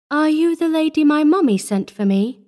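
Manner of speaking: put-on timid little girl's voice, held high; small piping child. A narrator's reading. Little orphan asking the visiting lady, small piping voice. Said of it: "her small voice piped".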